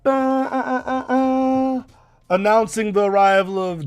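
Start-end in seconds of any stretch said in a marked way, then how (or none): 0.0-1.8 high, loud mock horn fanfare; trumpet fanfare. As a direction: blare it like a herald horn. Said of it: "there's a loud".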